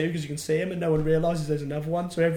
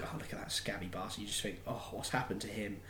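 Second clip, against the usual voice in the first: much softer, lower inner thought; quiet; 6 semitones lower, 15 dB quieter than usual. Retold or imitated. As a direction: murmur it to yourself.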